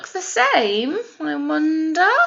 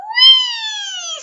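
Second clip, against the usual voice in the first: excited whee; 7 dB louder than usual. Pretend. Sliding down the pole.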